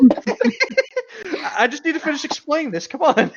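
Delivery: hammed-up begging through laughter; mock pleading. Plays passenger begging to keep talking.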